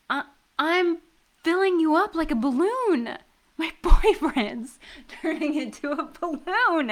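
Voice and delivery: high, loud, laughing thrill; giddy excitement. Acted. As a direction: babble with giddy glee. Giddy at his inflation.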